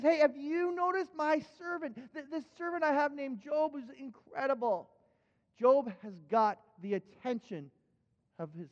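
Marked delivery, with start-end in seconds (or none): 0.0-4.9 higher, beaming boast about his servant; God showing off Job, voice lifted high; proud God bragging. Acted. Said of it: "God goes into this brag session on Job".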